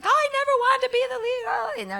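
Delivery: very high, fast, airy voice; high fluttery protest. Retold or imitated. Answering the director, protesting in a squeaky fast voice. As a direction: rattle it off high and quick. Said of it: "and I was like".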